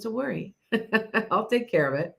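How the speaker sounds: soft chuckle breaking into the words; imitated laughing reassurance. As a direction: retell it lightly, laughing through it. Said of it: "he laughed when he said".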